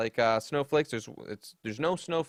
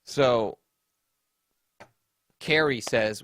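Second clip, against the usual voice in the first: usual speaking voice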